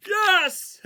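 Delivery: very high, very loud shout of joy; explosive celebration; triumphant yell. Real, not acted. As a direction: scream it like a last-second win. Game suddenly saved.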